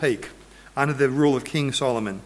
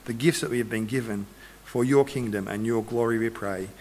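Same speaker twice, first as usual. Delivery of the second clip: low, soft praying voice; prayer; 6 semitones lower, 6 dB quieter than usual. Real, not acted. Preacher closing a prayer for the congregation, low and soft. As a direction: pray softly and low, settling into the amen.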